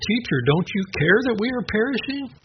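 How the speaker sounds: high, pleading cry of frightened disciples. Retold or imitated. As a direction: beg the teacher to notice the danger. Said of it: "they're quoted as saying".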